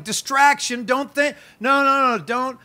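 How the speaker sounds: high, loud imitation of the devil yelling; mock devil's yelling. Preacher imitating the devil screaming at the church, mock yell. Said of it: "screaming at the church and yelling".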